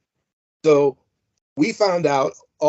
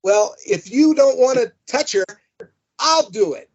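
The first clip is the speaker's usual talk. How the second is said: raised, higher retold yell; mock yell egging him on; 7 semitones higher than usual. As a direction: call it out across the theater. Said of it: "he's yelling out".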